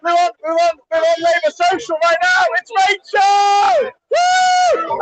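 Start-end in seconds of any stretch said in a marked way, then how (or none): 2.6-5.0 high delighted shout of a name; excited greeting. Real, not acted. Meeting online friends in real life, high excited shout.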